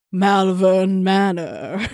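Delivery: very drawn-out, mock-ominous gentleman. Co-host contrasting the name with a dull building, ominous gentleman. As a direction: stretch it into a grand ominous gentleman's announcement. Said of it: "say it like an ominous gentleman".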